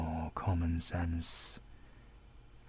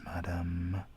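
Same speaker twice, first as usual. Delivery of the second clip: usual speaking voice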